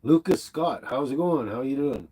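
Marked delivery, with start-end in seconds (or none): none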